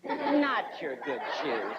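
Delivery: slow, low mock-mom reproach; mom talk. Mom looking at your shoes, drawn-out reproach. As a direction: stretch each word like a disapproving mom. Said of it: "they'll look at you and go".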